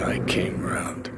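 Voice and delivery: hushed parting whisper. Acted. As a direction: breathe it close, almost secret. Quiet parting words.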